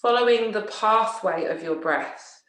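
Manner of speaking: slow, measured yoga nidra voice with long pauses; guided relaxation. Acted. Teacher settling listeners into yoga nidra, slow guided relaxation. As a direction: guide them unhurriedly into rest, leaving space after each phrase.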